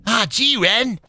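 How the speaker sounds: high dopey cartoon voice; goofy sidekick addressing his pal; Stimpy. Stimpy talking to Ren, high cartoon voice. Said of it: "Can you do Ren or Stimpy?".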